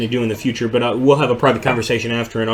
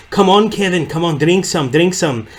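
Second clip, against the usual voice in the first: higher-pitched, imitated friend urging a drink; coaxing; 6 semitones higher, 3 dB louder than usual. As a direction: egg him on in a pitched-up mock voice. Imitating friend pushing him to drink.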